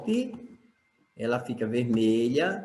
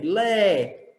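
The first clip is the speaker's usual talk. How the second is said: "Lé" held long and higher; stressed syllable; 6 semitones higher, 4 dB louder than usual. Shown as a demonstration. Teacher answering which syllable is strongest, "Lé" drawn out with stress. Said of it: "qual é a sílaba sorte em leve".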